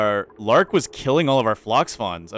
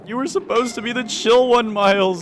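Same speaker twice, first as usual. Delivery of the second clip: exasperated scolding; 9 semitones higher than usual. Real, not acted. Streamer scolding colonist Miles for losing his cool, high and exasperated. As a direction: plead with the colonist, pitch up.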